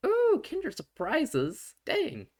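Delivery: high, slow, delighted coo over the candy; a child thrilled by Kinder Surprises, slow high delight; gleeful ooh. Acted. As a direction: squeal with kid glee at the treat.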